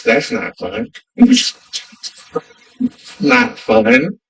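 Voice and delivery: normal talking voice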